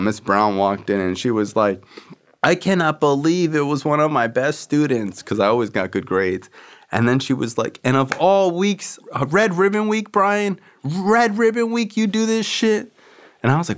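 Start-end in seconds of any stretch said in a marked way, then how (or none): 2.4-5.2 higher, put-on dismayed lady voice; high disappointed principal voice; shocked principal. 7.8-12.9 high, slow scolding lady voice; high, drawn-out scolding principal; exasperated principal. Retold or imitated.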